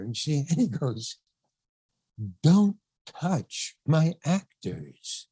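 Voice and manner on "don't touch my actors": very slow, word by word; spaced-out command, each word given its own weight; John Huston's stern warning. Retold or imitated. Director to casting director handling his actor, slow stern warning. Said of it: "Just like that".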